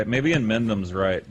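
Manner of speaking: ordinary delivery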